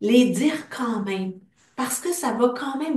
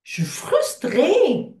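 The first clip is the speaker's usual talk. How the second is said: higher, louder, slow; emphatic frustration; 7 semitones higher, 4 dB louder, slower than usual. Acted. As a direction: let frustrée out, stretched and pitched up. Tapping affirmation, frustration.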